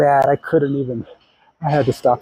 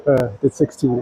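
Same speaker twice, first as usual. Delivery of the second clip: usual speaking voice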